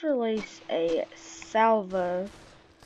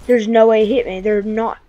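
much louder indignant shout; outraged disbelief; 10 dB louder than usual. Real, not acted. Gamer shot again, protesting in a loud shout. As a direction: yell it in disbelief at the game.

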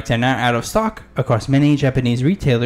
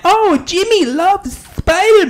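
much higher, slowed mom voice with a New York lilt; 4 dB louder, slower than usual. Retold or imitated. Host imitates a mom at GameStop asking for Spider-Man, high and gushing. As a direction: play a mom gushing over her son's favourite. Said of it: "a mom at a GameStop at Walmart".

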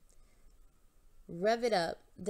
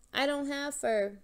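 protested; 6 semitones higher, 4 dB louder than usual. Acted. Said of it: "Phoebe protested".

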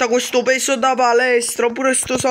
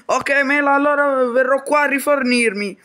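usual speaking voice

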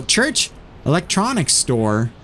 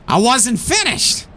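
high, drawn-out indignant protest; indignant outburst; 8 semitones higher, slower than usual. Acted. Boss cut off by the officer, indignant. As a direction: raise the pitch and stretch it out, indignant.

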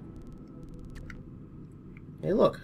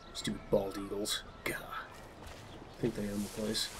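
softer whispered grumble; whispered aside; 5 semitones lower, 12 dB quieter than usual. Real, not acted. Muttered aside about eagles.

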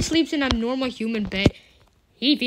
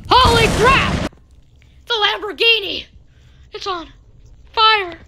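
high, loud alarmed exclamations; shocked outcry; 8 semitones higher, 5 dB louder than usual. Real, not acted. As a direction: react in shock to the fire. Sees Lamborghini burning.

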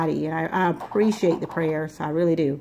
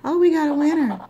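pitched-up mock yell of a big win; sudden excited outburst; 9 semitones higher than usual. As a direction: imitate her high, excited winner shout. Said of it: "all of a sudden it's like".

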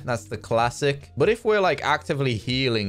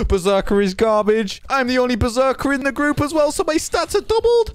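higher, louder frustrated outburst; exasperated rant; 11 semitones higher, 5 dB louder than usual. Real, not acted. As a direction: vent at the class, rising as you go. Ranting about his weak class.

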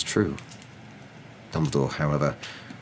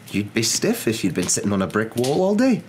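indignant retort; 6 semitones higher, 4 dB louder than usual. Acted. Voiced professor retorting.

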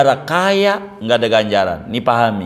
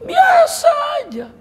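sudden high shout, much higher. Acted. Preacher shouts mock reaction. (teriakan keras bernada tinggi)

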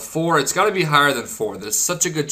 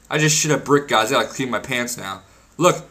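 ordinary delivery

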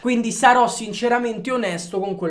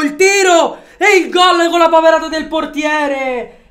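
shouted, stretched play-by-play; breathless italian goal commentary; 4 semitones higher, 8 dB louder, slower than usual. Real, not acted. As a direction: call the goal like a live commentator.

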